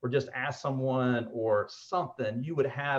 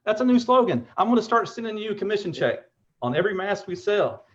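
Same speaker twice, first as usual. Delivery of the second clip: high, loud burst of delight; excited; 9 semitones higher, 5 dB louder than usual. Real, not acted. Host seizing on a new slogan, excited. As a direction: pitch it high and thrilled, like you struck gold.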